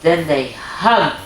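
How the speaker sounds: bright, lilting storybook narration; reading to children. A narrator's reading. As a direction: read it like a bedtime story for little ones.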